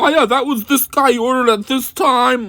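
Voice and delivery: squeaky parody of a clueless shop guy; mock pizza man. Mocking the pizza man's reply.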